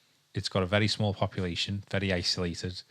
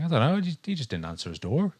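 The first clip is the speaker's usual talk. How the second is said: Irish impression attempt; 8 semitones higher, 4 dB louder than usual. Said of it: "what accent was that?".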